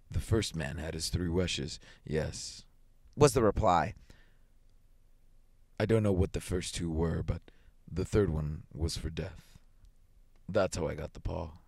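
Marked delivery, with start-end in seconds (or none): 0.1-2.6 low, soft and slow soldier; grave reply. A narrator's reading. 5.8-11.6 low, soft, sombre soldier; grave. A narrator's reading. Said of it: "His tones were so grave".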